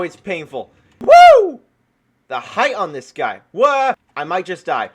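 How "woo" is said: a high, loud whoop of thrill; excited whoop. Real, not acted. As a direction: whoop as you fly up. Launched into the air by golem.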